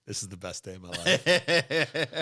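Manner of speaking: laughing as he quotes him, drawn out; slow, mock-delighted quote through laughter; imitating a gleeful friend. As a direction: chuckle through the gloating impression. Quotes gleeful friend teasing his joggers.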